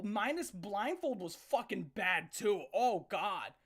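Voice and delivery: usual speaking voice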